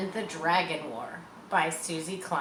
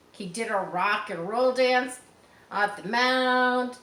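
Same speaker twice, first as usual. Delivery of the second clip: ordinary delivery, nothing marked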